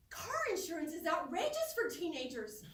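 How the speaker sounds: normal talking voice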